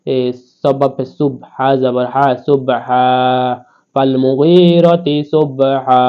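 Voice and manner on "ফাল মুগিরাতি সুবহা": slow, drawn-out Quran recitation; measured Quranic recitation. Shown as a demonstration. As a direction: chant it slowly like tajweed practice. Reciting the verse.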